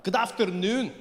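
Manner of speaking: slow put-on Scottish accent; posh-ish Scottish tourist voice. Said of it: "speak to people in their accent".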